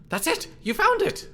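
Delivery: high, thrilled shout of discovery; excited. Acted. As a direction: cry out in delight. Galahad finds the item.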